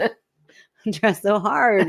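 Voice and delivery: very slow, stretched out; mock pleading; drawn out plea. Real, not acted. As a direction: drag each word out in mock despair.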